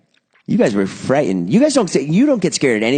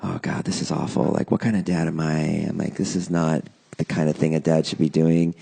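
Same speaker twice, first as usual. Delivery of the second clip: quiet worried; 6 semitones lower, 5 dB quieter than usual. Retold or imitated. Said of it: "and I was like".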